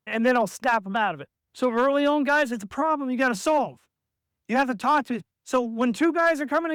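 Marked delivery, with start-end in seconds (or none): none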